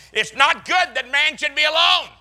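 high, loud preacher quoting Genesis; mock solemn scripture. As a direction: declaim it like holy writ for a laugh.

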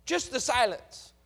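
ordinary delivery

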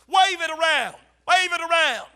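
loud, high rallying call. Real, not acted. Preacher urging congregation to wave their Bibles, loud calling.

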